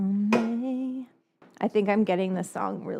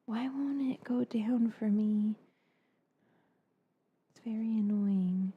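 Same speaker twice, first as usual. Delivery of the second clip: whispering; 7 dB quieter than usual. Real, not acted. Frustrated whisper at camera.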